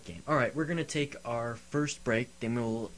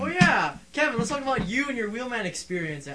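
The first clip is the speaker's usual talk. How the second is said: teasing high exclamation; 11 semitones higher, 4 dB louder than usual. Real, not acted. Teasing co-host, sudden high voice.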